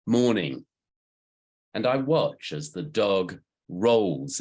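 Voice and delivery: deliberate recital, pitch lifting then falling away; poem reading. Acted. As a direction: recite in measured phrases, leaning on key words. Reads own poem about dog.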